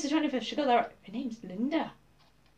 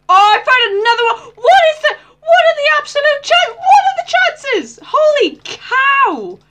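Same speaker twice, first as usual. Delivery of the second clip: very high, loud disbelieving cries; stunned shrieking; 13 semitones higher, 10 dB louder than usual. Real, not acted. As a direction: scream at the impossible luck. Another disc found, stunned shrieks.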